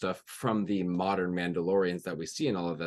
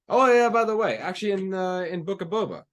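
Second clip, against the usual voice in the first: high, loud opening, easing in volume; imagined interjection, pitched well up; high-pitched casual quote; 10 semitones higher, 5 dB louder than usual. Retold or imitated. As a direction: toss in a high "oh, by the way". Voicing what he could have said.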